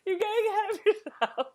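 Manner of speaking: high, laughing protest to start. Real, not acted. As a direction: giggle it out, then settle. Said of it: "This is like the Bridget Fonda".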